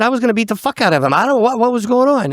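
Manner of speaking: high, rushed, worked-up reply; retold heated retort. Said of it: "I said".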